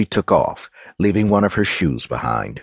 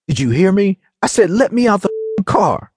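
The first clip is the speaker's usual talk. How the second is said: high, insistent outburst; heated, high-pitched ultimatum; angry demand; 13 semitones higher than usual. A narrator's reading. Demands release from locked car.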